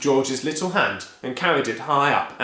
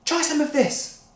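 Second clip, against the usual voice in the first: higher, gentle offer; lightly coaxing. Acted. George offering the hen medicine, lightly coaxing. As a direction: tempt the hen.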